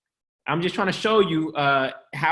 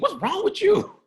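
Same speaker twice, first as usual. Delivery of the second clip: put-on high exasperated scold at the kids; mock fed-up parent yelling at kids; 14 semitones higher than usual. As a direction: snap it at your kids. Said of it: "To my kids all day".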